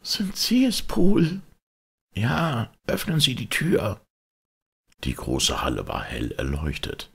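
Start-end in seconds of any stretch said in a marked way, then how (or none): 0.0-1.4 high, anxious maid calling out; voice from inside. A narrator's reading. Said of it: "eine Stimme fragte von innen". 2.1-4.0 put-on high, anxious old butler voice; frightened old servant. A narrator's reading. Poole answers.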